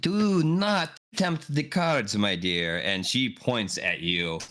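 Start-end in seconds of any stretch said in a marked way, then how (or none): 0.0-2.8 slow, warning mystic voice; ominous fortune teller. Acted. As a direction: lean in and warn them slowly. Fortune teller warns the players.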